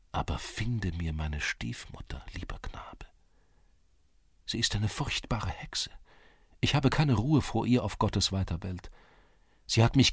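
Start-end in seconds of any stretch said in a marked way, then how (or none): 0.1-5.9 breathless whisper, a frightened secret; whispered plea. Acted. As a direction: whisper it as if the witch could hear. Frightened girl confides a secret. (ängstlich geflüstert, als könnte die Hexe es hören)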